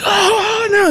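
high, hammed-up panicked wail; mock freaking out. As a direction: squeal it like a worker watching glass shatter. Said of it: "just starts freaking out".

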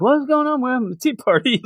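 high, breezy, laughing innocent voice; unconcerned tea party. Retold or imitated. Tea party friends brush off the screaming crowd, high and breezy. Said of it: "And they're like".

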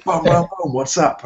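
high-pitched goofy hype; mock hyped Chris. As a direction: imitate a cocky friend showing off. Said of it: "Chris just being like".

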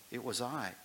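reading a story aloud to the church; measured storybook reading; story narration. A narrator's reading.

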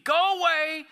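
high, loud taunting shout; mocking demon. Pastor retelling the demon taunting Jesus, loud mocking shout. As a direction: jeer at him like the demon. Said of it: "He's mocking the Son of God".

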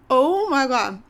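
loud shocked reaction to sour. Real, not acted. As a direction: recoil from the sourness. Just bit into sour grape.

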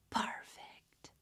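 put-on whispered TikTok girl; imitating her whisper. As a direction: breathe out the word like the clip. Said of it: "then she goes".

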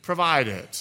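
preacher raising his voice to the church; preaching at full force. Acted. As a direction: build the fire of the sermon.

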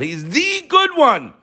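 high, slow proclamation; emphatic exclamation. Real, not acted. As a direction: stretch it out high and triumphant. Exclaiming the translation of Hatov.

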